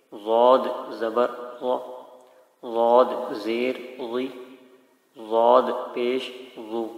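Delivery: "ضاد زبر غا" drawn out slowly; slow Qaida drill; slow clear spelling. Shown as a demonstration. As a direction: sound out "ضاد زیر غی" syllable by syllable. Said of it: "اس طریقے سے پڑھنا ہے".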